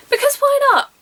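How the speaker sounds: cheeky rhetorical. Real, not acted. Mock-rhetorical aside about nudity.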